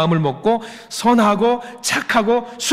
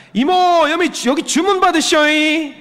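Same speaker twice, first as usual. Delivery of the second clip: a Filipino man's thick Jeolla dialect, much higher and louder; imitating Jeolla dialect; 11 semitones higher, 3 dB louder than usual. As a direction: call the waitress over in hammed-up Jeolla dialect. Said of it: "전라도에서 배웠어요". (전라도 사투리로 식당 이모를 부르는 흉내)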